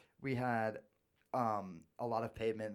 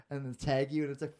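ordinary delivery

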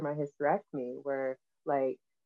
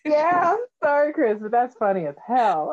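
amused laughing; 8 semitones higher, 6 dB louder than usual. Real, not acted. Cracking up at the story.

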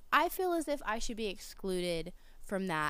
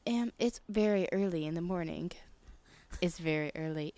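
whisper; 3 semitones lower, 4 dB quieter than usual. Retold or imitated. Tired reply to the yelling worker.

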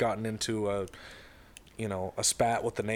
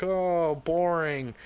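higher, drawn-out complaint; mock bored groan; 5 semitones higher, much slower than usual. Kids hear of the lawn store trip, mock bored groan.

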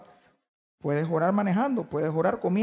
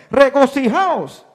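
higher and much louder, an exclamation; exclaimed; 6 semitones higher, 11 dB louder, slower than usual. Shown as a demonstration. Preacher showing how the verse must be read, exclaimed loudly. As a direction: cry it out like a command to rejoice. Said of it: "admiración".

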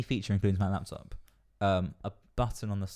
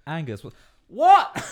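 louder, slow confused put-on voice; mock deaf rocker; 7 semitones higher, 11 dB louder, slower than usual. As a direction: play a deaf old rocker shouting. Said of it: "it would be like".

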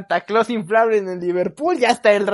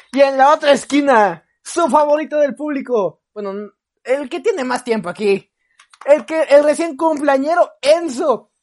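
boxing announcer; 4 semitones higher than usual. Acted. Introducing Enzo like a boxer.

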